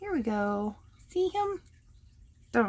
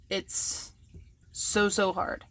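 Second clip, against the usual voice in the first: sarcastic; slower than usual. Real, not acted.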